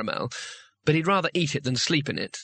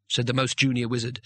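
ordinary delivery

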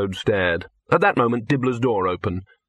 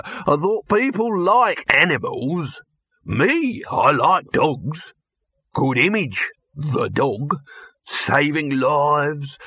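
higher-pitched pitchman voice; brash hustling producer; 4 semitones higher, much slower than usual. Acted. Dibbler pitching his idea to Victor, high brash showman voice. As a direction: sell the idea eagerly, pitch riding up.

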